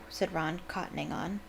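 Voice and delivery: plain reading voice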